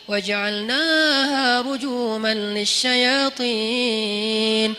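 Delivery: slow, chanted tilawah; Quran recitation. Acted. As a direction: recite it slowly like at a mosque. Reciting Surah Al-Mulk. (tilawah pelan dan berirama)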